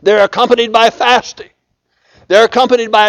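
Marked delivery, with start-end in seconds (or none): none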